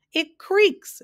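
very high, loud outburst; high, jubilant holler; gleeful bellow. A narrator's reading. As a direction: bellow it on the edge of hilarity. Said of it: "bellowed the Din".

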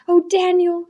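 breathless, high gasp. A narrator's reading. Thacia gasping to Daniel in distress. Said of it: "she gasped".

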